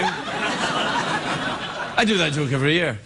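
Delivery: usual speaking voice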